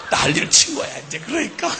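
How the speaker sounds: ordinary talk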